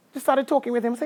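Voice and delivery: ordinary delivery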